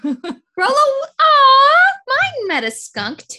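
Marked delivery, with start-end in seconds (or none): 1.2-1.9 a squealed, high aw of pity; high sympathetic aw. Real, not acted. Friend hearing the dog met a skunk, high squealed aw. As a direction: coo at the poor skunked dog.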